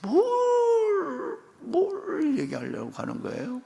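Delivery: sulky, grumbling complaint; Abraham sulking to God about no heir; grudging Abraham. Acted. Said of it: "찝찝해서".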